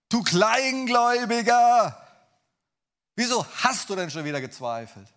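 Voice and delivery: loud, high, thundering scolding; acted angry outburst, opening word stretched; harsh reproach. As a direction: bellow it as an accusation. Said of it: "wie hörst du denn so einen Satz von Jesus?".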